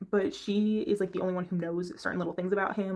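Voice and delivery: ordinary delivery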